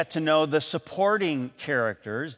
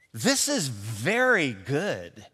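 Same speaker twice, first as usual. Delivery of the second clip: slow, soft "very good"; slow sincere praise; 3 dB quieter, much slower than usual. Shown as a demonstration. Answerer assuring the cook it's truly good, slow and sincere. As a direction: lean in and mean every word.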